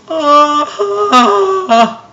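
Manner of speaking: a very high, short ah of horror; shocked high gasp. Retold or imitated. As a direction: gasp as if you heard the snap. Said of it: "And you're like".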